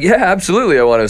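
high, enthusiastic yes; retold eagerness. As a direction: act out jumping at the chance.

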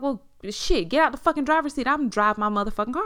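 loud, quick imitation of an angry boyfriend; retold angry order. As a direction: act out ordering someone out of the seat. Retold quarrel, boyfriend ordering her out.

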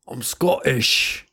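mock Scottish accent, slow and defiant; lightly put-on Scottish brogue, daring the Irish; a hint of a Scottish accent. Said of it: "that was a Scottish accent I did".

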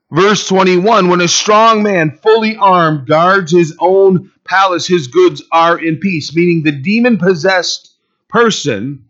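loud, high, emphatic sermon delivery; forceful preaching. Real, not acted. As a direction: drive the verse home to the congregation.

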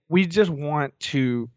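usual speaking voice

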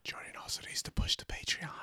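whispered aside. Real, not acted. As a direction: slip it in under your breath. Whispered jab.

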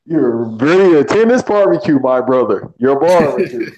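loud, punched roaster impression; playfully booming taunt; mock roast catchphrase.

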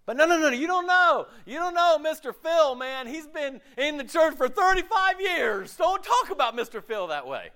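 high, loud protesting defense; indignant church member. Retold or imitated. Church member defending Mister Phil, high indignant protest. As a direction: bristle defending an old friend. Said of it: "defensive church member objecting".

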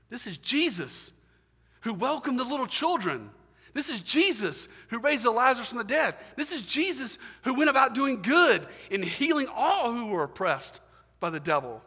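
high, loud, rising preacher's crescendo; fervent pulpit climax, voice lifted high; full-force preaching. Acted. Preaching crescendo on Jesus' deeds.

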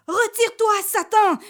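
very high, loud banishing shout; exorcising cry. Acted. Priest striking Satan with his stole, high banishing shout. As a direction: cast the devil out with full force. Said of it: "s'écria le curé".

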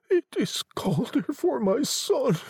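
put-on high, grieving old woman; tearful. A narrator's reading. Old woman grieving for her son, tearful. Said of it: "who began crying again".

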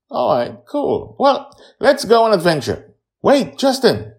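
put-on high, loud kids; eager children talking; child voices. A narrator's reading.

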